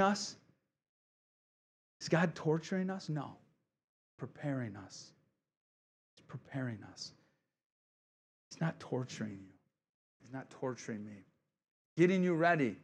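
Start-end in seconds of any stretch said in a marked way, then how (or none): none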